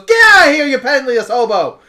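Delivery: loud, high yell to drive someone off; angry yell. Acted. Shopkeeper throws out a penniless visitor, angry yell. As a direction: yell it like you're throwing them out.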